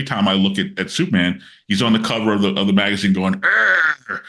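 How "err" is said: grunting brute. Retold or imitated. Superman on modern covers grunting about punching, hammed-up brute voice. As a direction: ham up a dumb punching brute.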